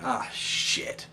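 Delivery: breathless whispered ah shit; whispered dread. Retold or imitated. Fan whispering to himself as lights go out, hushed dread. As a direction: hush it out in quiet dread. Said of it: "and I'm thinking".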